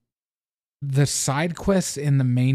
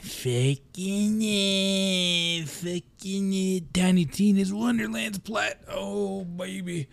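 giddy excitement; 7 semitones higher, 3 dB quieter, much slower than usual. Real, not acted. Gloating over a nearly won platinum.

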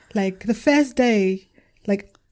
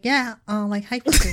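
nasal, high-pitched voice. Retold or imitated. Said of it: "nasally, like, very high pitched".

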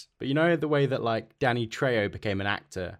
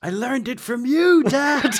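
mock daughter; 13 semitones higher, 7 dB louder, slower than usual. Daughter voiced after killing cat.

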